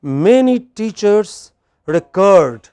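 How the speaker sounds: slow example, "record" as reCORD; teacher's slow model of reCORD as verb; verb stress on reCORD. Shown as a demonstration. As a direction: stretch it out, second syllable of "record" strong. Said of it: "But look at the verb".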